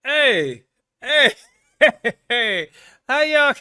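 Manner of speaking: loud, high laughing exclamations; amazed outburst. Real, not acted. Host reacting to a Londoner's American accent, loud and amused.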